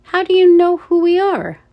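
high, loud startled question; cried out. A narrator's reading. Ella challenges the guard, cried out. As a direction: cry it out in alarmed disbelief. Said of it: "Ella cried".

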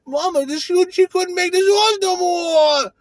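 wailing mock grief about Mama; mock crying. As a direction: fake a tearful breakdown, voice cracking up high. Mock-tearful breakdown over Mama.

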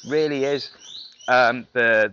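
normal talking voice

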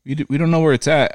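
high, breezy lady agent voice; mock phone agent. Mocking the FedEx lady to his cohost in a high voice. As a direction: pitch way up and play her careless.

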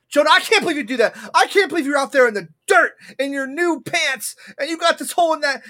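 high, fast, mock-angry scolding of a child; mom yelling in imitation. Mother imitated scolding her son Jonah, high and fast. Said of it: "she's here yelling at you".

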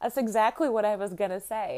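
laughing admission; amused agreement. Retold or imitated. Mom answering son's tease, amused laughing agreement. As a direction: own up through a laugh. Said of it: "I said".

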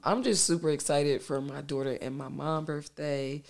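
ordinary delivery

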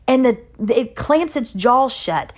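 normal talking voice

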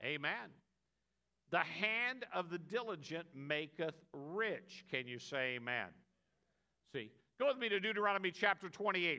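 ordinary delivery

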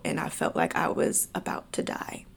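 soft, whispered confession; hushed whisper. Real, not acted. Telling viewers about her panic attack, a hushed whisper. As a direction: drop to a near whisper as fear returns.

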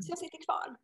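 hushed, breathy whisper. Real, not acted. Telling the hosts about the taxi, whispered. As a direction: drop to a whisper, as if confessing. Recalling a scary taxi ride.

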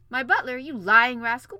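loud, indignant outburst; enraged king. A narrator's reading. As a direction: roar at an impudent servant in outrage. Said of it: "opened the door in a rage".

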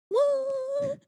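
very high, drawn-out "what"; sustained high-pitched noise of a blown mind. Pretend. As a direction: squeal out a long, high, baffled sound. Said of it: "My mind just went".

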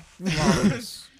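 diva flourish. Pretend. Quoting [name] line.

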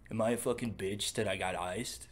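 a hint of a worried bro; lightly voiced anxious tough guy; insecure macho Chad. Imitating a masculine Chad, lightly voiced. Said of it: "overly fucking trying to be masculine Chad".

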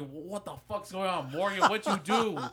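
high, loud frantic questions; mock panic waking everyone; panicked yelling. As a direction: shout in half-laughing panic. Panicked wake-up retold.

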